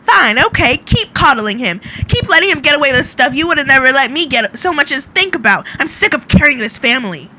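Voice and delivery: loud, exasperated rant in character; flustered shouting. Acted. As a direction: shout it in one fed-up rush. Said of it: "shouting behind her".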